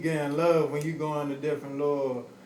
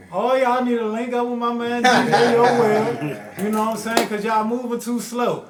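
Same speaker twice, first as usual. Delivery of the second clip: raised, higher promoter's pitch; hype shout-out; 7 semitones higher, 9 dB louder than usual. Real, not acted. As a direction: call out loudly to rally other cities. Hyping DJ to other cities.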